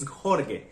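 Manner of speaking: "Jorge" as HOR-geh, slowly; hard-G misreading. Shown as a demonstration. Foreigner tired of his misread name, hard-G misreading. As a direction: say "Jorge" with a hard G in the second syllable. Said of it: "H, H O R G E".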